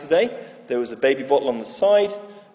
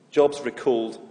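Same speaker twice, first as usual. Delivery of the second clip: normal talking voice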